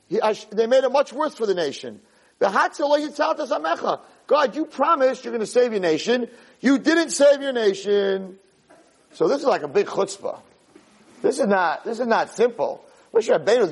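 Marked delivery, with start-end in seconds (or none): 2.4-8.4 high, acted-out reproach; yelling accusation. Moshe confronts God over a broken promise, yelling. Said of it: "you're yelling at me".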